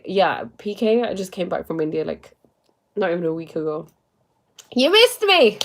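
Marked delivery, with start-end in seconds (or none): 4.7-5.6 high, loud teasing cry of you missed me; playful exclaim. Real, not acted. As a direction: tease a fan who came late, bright and loud. Teasing a viewer who missed.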